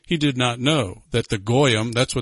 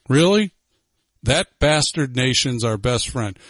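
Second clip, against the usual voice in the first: ordinary talk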